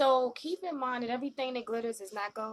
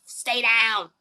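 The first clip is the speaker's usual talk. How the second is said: mock urgent order; 2 semitones higher, 13 dB louder than usual. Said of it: "She's like".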